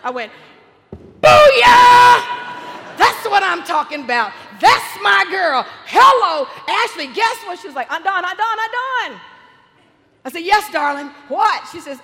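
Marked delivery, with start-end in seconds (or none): 1.2-7.6 full-volume victory yell, pitched high; jubilant shout. Retold or imitated. Aunt hears niece made the team, jubilant high shouting. 7.9-9.2 high, loud repeated name-calling; insistent calling. Retold or imitated. Niece trying to interrupt cheering aunt, high insistent calls. 10.4-11.7 loud, raised, elated answer; excited reply. Retold or imitated. Aunt still celebrating answers her niece, loud and excited.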